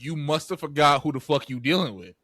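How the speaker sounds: loud, tough gangster talk; tough baby voice. Pretend. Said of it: "She's like".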